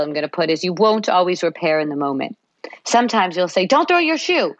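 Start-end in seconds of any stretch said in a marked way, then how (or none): 3.7-4.6 angry parent. Retold or imitated. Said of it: "put the shoe on angrily".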